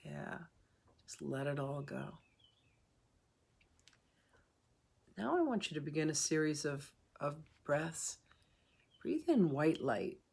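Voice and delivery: calm meditation guide with long pauses between phrases; guided meditation. Real, not acted. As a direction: ease listeners through each breath, unhurried.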